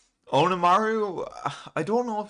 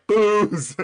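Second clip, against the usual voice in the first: high, loud mock shout; Zoro; 10 semitones higher, 6 dB louder than usual. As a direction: imitate someone yelling for a drink. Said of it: "they're both kind of up shouting".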